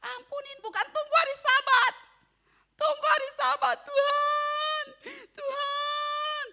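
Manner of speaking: high, loud wailing plea to God; mock a believer crying to God; whining believer. Congregant's whiny prayer mocked. (meniru jemaat merengek keras dan tinggi)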